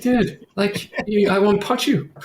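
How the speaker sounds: high, laughing retort; half-laughing, mock outrage; laughing mock anger. Retelling a frustrating contractor, laughing high. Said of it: "I'm like".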